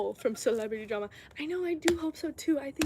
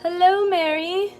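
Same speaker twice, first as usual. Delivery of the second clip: higher, louder, slow singsong hello; cheery greeting; 7 semitones higher, 13 dB louder, slower than usual. Real, not acted. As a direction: greet the viewer brightly. Greeting a viewer.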